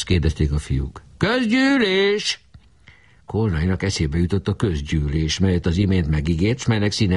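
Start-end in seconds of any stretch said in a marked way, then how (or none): 1.2-2.4 very high, slow, stretched call; drawn-out demand. A narrator's reading. Barabás calling for the meeting, slow and high. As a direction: stretch the word out mockingly.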